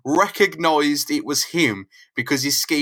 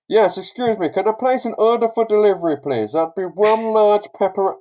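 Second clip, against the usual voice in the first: high, louder, sugary-polite phone order; overly polite caller; 7 semitones higher, 4 dB louder than usual. Retold or imitated. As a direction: place a pizza order in a put-on polite voice. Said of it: "he's just like".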